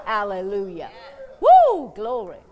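loud joyful cry of praise; rousing shouts. Real, not acted. Preacher whipping up the church with shouts. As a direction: shout it out to the congregation.

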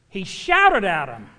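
"shouted" drawn out and loud; slow, punched "shouted" with a falling end; stress on shouted. Real, not acted. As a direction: lean hard and long on "shouted". Preacher stresses that Peter shouted.

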